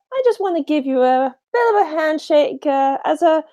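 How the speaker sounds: somewhat high, polite voice; lightly voiced wrestler. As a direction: offer the handshake politely. Wrestler offers handshake.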